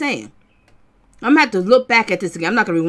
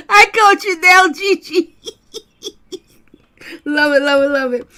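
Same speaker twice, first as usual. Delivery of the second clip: higher, louder, laughing through the words; gloating playfully at Gigi; laughing delight; 4 semitones higher, 5 dB louder than usual. Real, not acted. Laughing, caught a viewer.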